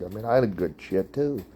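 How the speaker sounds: normal talking voice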